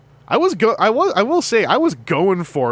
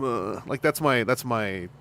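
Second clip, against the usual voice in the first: ordinary delivery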